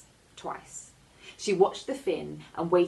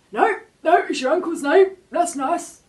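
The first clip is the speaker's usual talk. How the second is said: high, loud, odd cartoon shark voice; a big shark owning up; sheepish shark; 13 semitones higher, 13 dB louder than usual. A narrator's reading. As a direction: shark admitting it was wrong to the girl.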